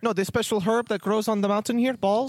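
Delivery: high, bubbly elf voice; cheery high-pitched elf innkeeper welcome; jolly elf Festo. Acted. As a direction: greet them like the jolliest elf alive.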